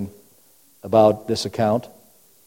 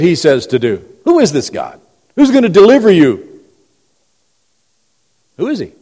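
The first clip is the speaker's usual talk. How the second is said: mock challenge; 5 semitones higher, 5 dB louder than usual. Preacher voices Babylon's challenge.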